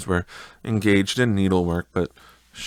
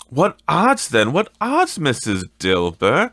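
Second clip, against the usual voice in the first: high, loud defiant woman; high brassy charwoman; 6 semitones higher, 5 dB louder than usual. Acted. The charwoman challenging Missus Dilber, high and defiant. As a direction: toss it off high and bold.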